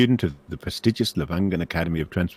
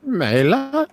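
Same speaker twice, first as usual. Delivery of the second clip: put-on very high, loud elderly query; distant old professor; 6 dB louder than usual. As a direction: echo the name in a high old man's voice. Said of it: "the Magister says distantly".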